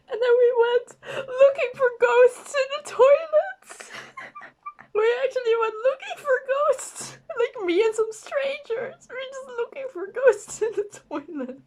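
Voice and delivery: high giggling through the words; can barely get it out for giggling; laughing. Real, not acted. Telling the ghost hunt story, high giggly laughter.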